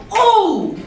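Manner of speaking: high-pitched, drawn-out cheer of approval; demo audience hype. Shown as a demonstration. Host modelling responses for poetry audience, high hyped-up cheer. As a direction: call it out like an approving crowd member. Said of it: "you can say certain things".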